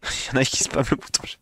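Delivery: laughing whisper; breathy aside, chuckling. Real, not acted. Laughing whisper about spam.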